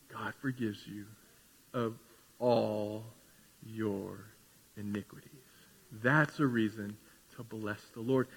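very soft, low, with pauses between words; hushed, reverent. Real, not acted. Preacher telling congregation of God's forgiveness, hushed and reverent. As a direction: let each word land quietly and low.

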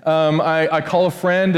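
normal talking voice

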